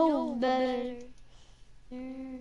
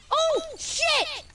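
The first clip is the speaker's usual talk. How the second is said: much higher, loud yelp; surprised shout; 10 semitones higher, 5 dB louder than usual. Real, not acted. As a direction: blurt it in shock. Surprised by accidental kill.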